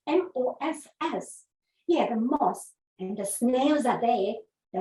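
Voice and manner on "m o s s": M O S S spelled letter by letter; spelling it out. Shown as a demonstration. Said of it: "very moss".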